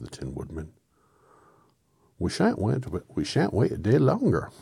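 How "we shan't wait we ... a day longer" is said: high, loud Irish Scarecrow; accidental Irish accent. Acted. Scarecrow protesting to Oz about waiting, in a high Irish accent. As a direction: slip into an excitable Irish lilt. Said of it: "He was Irish all of a sudden".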